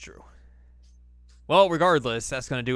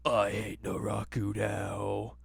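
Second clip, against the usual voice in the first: slow sulky voice; 3 dB quieter, much slower than usual. Retold or imitated. Voicing sulking Koga.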